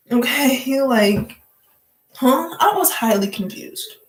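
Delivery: ordinary talk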